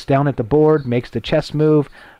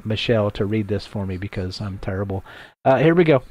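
ordinary talk, nothing marked

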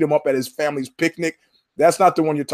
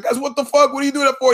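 much higher, indignant yelp; outraged protest; 10 semitones higher, faster than usual. Retold or imitated. Man being beaten at a picnic cries out in high outrage. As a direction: squeal it in disbelief.

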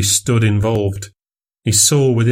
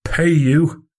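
a thin, hollow, hoarse question, pitched higher; barely find your voice, stunned; hollow ghost of his own voice; ghostly hoarse voice; 7 semitones higher than usual. A narrator's reading. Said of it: "Fettes found a voice, the ghost of his own".

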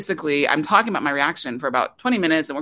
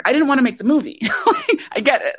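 laughing through the words, pitch jumping up; light real laugh while talking; 8 semitones higher, 3 dB louder than usual. Real, not acted. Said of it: "laughs while saying".

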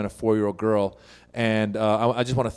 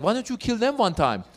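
mom's voice; 8 semitones higher than usual. Retold or imitated. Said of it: "She's like".

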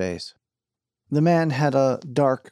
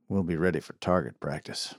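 low quiet threat; 5 semitones lower, 6 dB quieter than usual. Acted. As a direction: lower and softer, menacing. Sheriff repeats, darker.

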